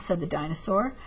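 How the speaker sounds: ordinary delivery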